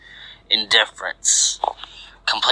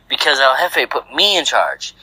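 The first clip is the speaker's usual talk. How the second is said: insistent retort; 5 semitones higher than usual. A narrator's reading. Argument between two henchmen.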